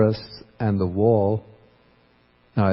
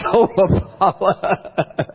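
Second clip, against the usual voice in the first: slow, stretched call with a laugh; drawn-out call; 10 semitones higher, much slower than usual. Retold or imitated. Monk calls the villager again, slow and laughing. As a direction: drag the name out, half laughing. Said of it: "I go outside the kuti and I yell".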